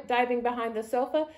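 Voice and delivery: ordinary delivery, nothing marked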